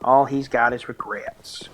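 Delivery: slow, drawn-out, hammed-up Southern accent; bad Southern accent. Streamer joking about a legless demon, bad Southern accent. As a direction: drawl it like a terrible Southern impression. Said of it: "went really badly southern there".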